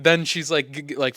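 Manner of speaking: ordinary talk, nothing marked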